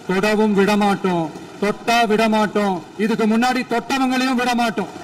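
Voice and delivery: loud, emphatic political pledge; real oratorical force, building; forceful vow. Real, not acted. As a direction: raise your voice and hammer out each refusal. Emphatic vow in assembly speech.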